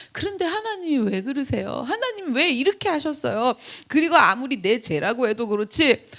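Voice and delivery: usual speaking voice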